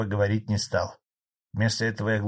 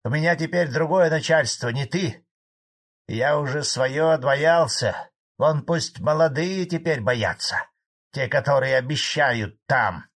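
raised, defiant retort; angry old farmer; 5 semitones higher, 5 dB louder than usual. A narrator's reading. Old farmer defies his former boss, angrily. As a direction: insist you are past being afraid. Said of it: "злобно ответил старый колхозник".

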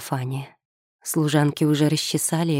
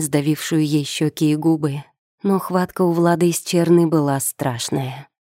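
usual speaking voice

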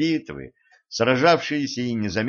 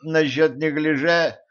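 ordinary delivery, nothing marked

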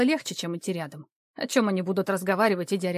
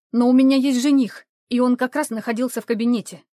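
indignant; 3 semitones higher, 7 dB louder than usual. A narrator's reading. Heated protest to Vadim.

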